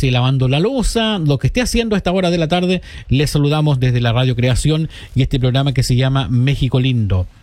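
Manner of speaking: ordinary talk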